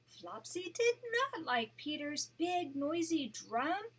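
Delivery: gentle read-aloud storytelling voice; storybook narration for a grandchild; story narration. A narrator's reading. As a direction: read it like a bedtime picture book. Grandma reads picture book aloud.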